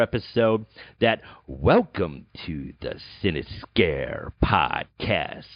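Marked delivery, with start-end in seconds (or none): 1.5-5.4 low, slow announcer voice; a hint of the intro narrator; podcast intro voice. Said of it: "You know that voice".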